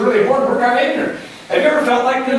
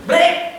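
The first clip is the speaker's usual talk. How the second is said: mock sheep bleat, pitched up; 9 semitones higher than usual. Sheep stuck on its back.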